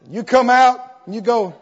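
ordinary delivery

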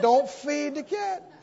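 ordinary delivery

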